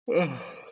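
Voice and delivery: long weary sigh; tired sigh. Real, not acted. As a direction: sigh out heavily, worn out. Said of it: "sorry, I'm getting tired".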